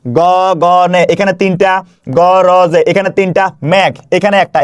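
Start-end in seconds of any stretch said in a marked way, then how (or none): 0.0-1.0 গগনে in three held syllables. Shown as a demonstration. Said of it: "এখানে কয়টা অক্ষর আছে". 2.2-2.8 গরজে in three held syllables. Shown as a demonstration. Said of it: "এখানে কয়টা অক্ষর আছে".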